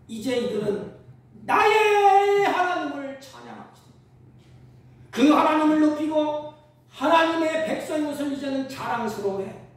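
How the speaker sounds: loud, high, stretched pulpit delivery; impassioned preaching. Acted. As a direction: proclaim the victory at full force. Preaches at full force.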